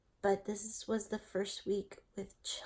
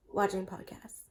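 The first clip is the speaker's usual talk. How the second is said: whispering. Real, not acted.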